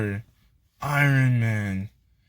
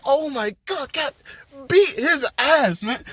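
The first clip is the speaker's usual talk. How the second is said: hyped cheering; 11 semitones higher than usual. Retold or imitated. Fan urging Captain America against Thanos, hyped high cheer. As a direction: cheer him on from the seat, drawing it out.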